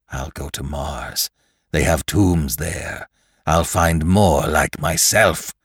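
slow, dark, drawn-out defiance; defiant Lantry. Acted. Cornered killer insists on his plan, slow defiance.

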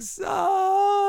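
put-on high wailing old man voice. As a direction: wail it like an ancient grandpa. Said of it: "that sound of Abe just going like".

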